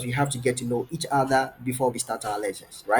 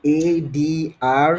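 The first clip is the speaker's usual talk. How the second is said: slow, louder, letter by letter; spelling out; 4 dB louder, much slower than usual. Shown as a demonstration. Tutor helping a student spell her name, slow spelling out. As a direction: spell it out slowly and distinctly. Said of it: "Can you spell it".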